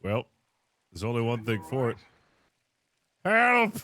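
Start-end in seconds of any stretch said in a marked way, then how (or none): 3.2-3.8 loud, higher cry for help; one more shout for rescue; calling for help. Acted. Trapped captain calling out loud for help.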